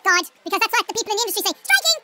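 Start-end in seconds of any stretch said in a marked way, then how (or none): 1.7-2.0 raised warning cry of STRIKING; shouted STRIKING. Shown as a demonstration. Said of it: "You need to shout striking".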